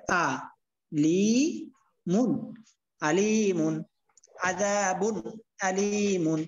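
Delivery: আযাবুন আলিমুন drawn out very slowly, syllable by syllable; slow model reading. Shown as a demonstration. Teacher models slow recitation for the student. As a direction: read it slowly and carefully as a model. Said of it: "যেমন এই যে আপনি যেমন এটা উচ্চারণ করবেন".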